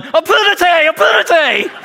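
high, loud shouted hurry-up; yelling at everyone to hurry to the cart. Retold or imitated. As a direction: shout the crew into motion. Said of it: "I was the one yelling".

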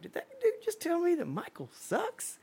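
high, stunned mock question; disbelief. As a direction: play it like he can't believe his ears. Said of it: "And Steve was like".

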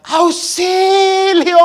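high, loud, slow hammed-up scream for help; mock cry for help. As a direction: stretch out a melodramatic damsel's shriek. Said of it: "comenzó a gritar".

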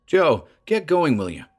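snapped. Acted. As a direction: bark it impatiently. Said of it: "he snapped".

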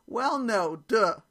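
loud, laughing "duh" retort; amused, emphatic comeback; mock exasperation. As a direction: state the obvious to a clueless kid. Mother's retort to clueless daughter.

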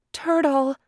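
put-on high, alarmed young deer; high anxious doe voice; Deer's voice. A narrator's reading. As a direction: cry out his name in worried surprise. Deer calls out to Turtle.

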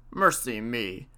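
a hint of the old husband's astonishment; old man exclaiming. A narrator's reading. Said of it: "says the old man".

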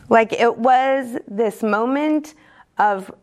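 ordinary delivery

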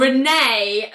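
mildly stern telling-off by name; imitated stern warning; really stern. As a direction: say the name as a reprimand. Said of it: "like, really stern".

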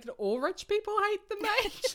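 high, loud, wide-eyed question; naive surprise. Retold or imitated. Simon asking Lady Ruth innocently, high and loud. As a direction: ask it in innocent astonishment. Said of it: "I love that Simon's like".

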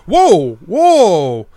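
loud startled whoa, falling; alarmed whoa. Acted. Evan shouting at the bandits taking the horses, startled and loud. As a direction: call out to stop the robbers. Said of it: "And Evan's like".

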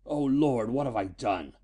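anguished groan, dropping at the end. A narrator's reading. Father realizes what his invention did, groaning. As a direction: groan it out in dawning guilt. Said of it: "groaned Shelton".

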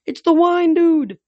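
high, loud, drawn-out yell; yelled alarm. A narrator's reading. Horse announcing the wine god, yelling. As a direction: holler it, stretching the words. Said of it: "Blackjack yelled".

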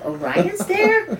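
high, slow, stretched-out disbelieving question; startled disbelief. Retold or imitated. Grandma doubting her granddaughter, slow incredulous question. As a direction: drag it out like you can't believe your ears. Grandma hears the child's claim.

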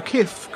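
kifk, KFC squashed into one syllable; mispronounced. Acted. Diner backs up friend's sighting, mispronouncing KFC. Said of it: "I think you saying K F C".

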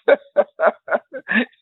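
a real burst of laughter in disbelief; disbelieving laugh. Real, not acted. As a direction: laugh at how absurd it was. Laughs recalling racist remark.

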